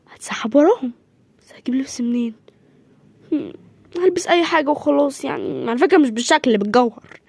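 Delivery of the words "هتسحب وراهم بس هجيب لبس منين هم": whispered, plotting under the breath; scheming whisper. Acted. Boy plans to sneak out after the family, whispering. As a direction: whisper the plan so no one hears.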